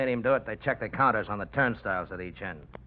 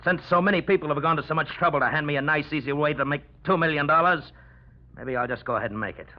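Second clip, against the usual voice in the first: loud, raised-pitch threat; menacing defiance; 4 semitones higher, 5 dB louder than usual. Acted. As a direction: take command with the gun, loudly. Gunman turns on the others.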